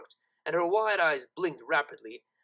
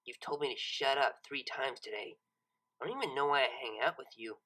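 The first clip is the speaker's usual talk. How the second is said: lower, softer and venomous; 4 semitones lower, 6 dB quieter than usual. A narrator's reading. C-Top bitterly turning on Lyra, venomous. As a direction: let the resentment drip off each word. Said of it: "voice dripped with venom".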